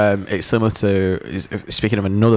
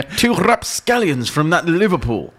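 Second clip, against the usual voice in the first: higher, plummy mock-polite toff; posh disguised gentleman; 8 semitones higher than usual. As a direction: greet the shopkeeper as a pompous gent. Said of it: "put on a fake moustache".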